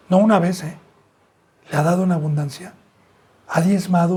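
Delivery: normal talking voice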